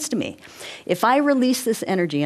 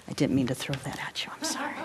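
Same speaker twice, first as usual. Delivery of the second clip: quick unvoiced aside; whispered apology; 5 semitones lower, 8 dB quieter, faster than usual. Real, not acted. Teacher apologising to a student in a quick whisper. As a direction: breathe the apology out fast under the breath.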